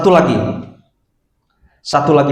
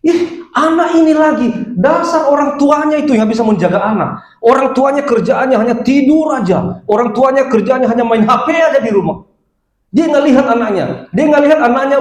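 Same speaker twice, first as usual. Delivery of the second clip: high-pitched mock scolding of a neighbour woman; 11 semitones higher than usual. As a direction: imitate an annoyed woman nagging about lazy parents. Angry neighbour woman scolding, retold.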